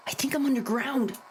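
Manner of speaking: usual speaking voice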